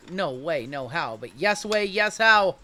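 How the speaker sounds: normal talking voice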